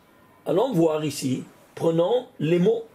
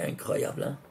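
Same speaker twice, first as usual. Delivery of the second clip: incroyable whispered, much softer; awed whisper; 6 semitones lower, 8 dB quieter than usual. Real, not acted. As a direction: hush it out in wonder. Whispered wonder after Sarah.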